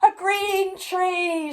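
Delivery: slow, high, loud cheering shout; crowd shout. A narrator's reading. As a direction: shout it out together. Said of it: "shouted the crowd".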